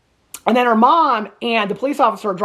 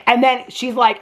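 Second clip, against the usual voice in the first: ordinary delivery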